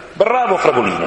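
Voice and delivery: high, bright exclamation of delight; exclaiming. A narrator's reading. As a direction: cheer the horse on. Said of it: "esclamò Polly". (Polly esclama contenta, acuta)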